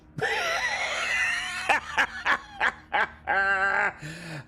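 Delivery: big laugh. Real, not acted. Laughing at own crashing record.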